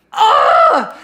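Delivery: cringe laugh. Real, not acted.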